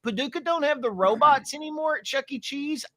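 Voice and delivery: high-pitched disbelieving question; shocked disbelief. Retold or imitated. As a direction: squeak it out in shock. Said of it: "I was like".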